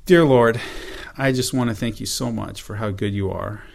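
soft, humble closing prayer; hushed heartfelt prayer to close. Acted. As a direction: pray quietly to the Lord.

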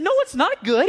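angry king. Retold or imitated. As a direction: pitch up high and snap it out. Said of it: "He was mad".

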